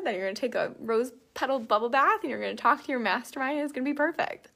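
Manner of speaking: high, loud, cheerful bossing around; bossy body voice. Retold or imitated. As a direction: lay down the law with glee. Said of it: "and my body was like".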